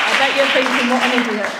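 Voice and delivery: mock self-put-down.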